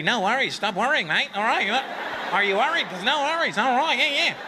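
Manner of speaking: high, hammed-up Australian reassurance; mocking Australians for always saying no worries, in a high put-on Australian accent. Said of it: "that you have to tell everybody".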